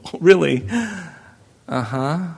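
mock doubting parent, a rising "really"; sarcastic disbelief. Parents doubting their daughter, in mock sarcastic disbelief. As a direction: scoff like an unconvinced parent. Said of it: "parents' door".

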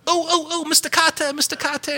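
high, nasal-free squeaky eager student calling out; Arnold Horshack. Retold or imitated. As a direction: wave your hand and plead to be called on, way up high. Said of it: "Remember Arnold Horshack in, uh, Welcome Back, Kotter?".

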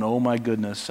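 hushed, sinking "oh my goodness"; quiet dread. Pretend. Peter seeing the wind and waves, quiet fear. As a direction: Peter realizing the danger, under his breath. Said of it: "he's going".

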